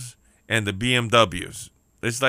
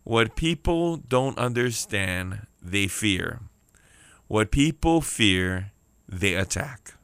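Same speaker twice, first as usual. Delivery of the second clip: slow, measured takeaway with pauses; deliberate teaching line; real slow; slower than usual. Real, not acted. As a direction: spell it out slowly, dropping on each ending. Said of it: "I'll say that real slow again".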